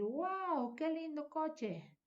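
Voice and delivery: put-on high, slow, wide-eyed kid voice; a little boy marveling at a car; excited child. Shown as a demonstration. Boy delighted by a new toy car, high and excited. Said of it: "ese niño puede decir".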